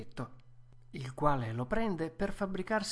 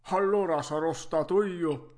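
vecchietto irritato, ribatte forte; sarcasmo stizzito; 3 semitones higher, 6 dB louder than usual. Acted. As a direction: rimbecca a voce alta, stizzito. Lite che ricomincia. (stizzito e sarcastico)

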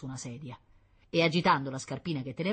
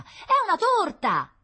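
very high, loud, slow insistence; child crying out the truth; protesting little girl; 9 dB louder, slower than usual. A narrator's reading. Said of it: "protestò Rita". (protesta acuta e insistente)

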